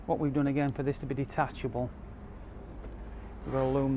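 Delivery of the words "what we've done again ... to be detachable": normal talking voice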